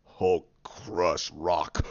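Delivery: slow, very low monster rasp; Hulk. Retold or imitated. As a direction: grind it out slowly like a brute. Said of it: "you have Hulk just look at it go".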